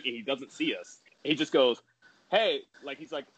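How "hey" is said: higher, louder imitated call to a crowd; sarcastic crowd address. Wrestler on the mic calling to the crowd, imitated sarcasm. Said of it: "being sarcastic, like, talking to the crowd".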